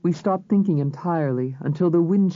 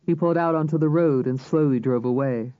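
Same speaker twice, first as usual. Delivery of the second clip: usual speaking voice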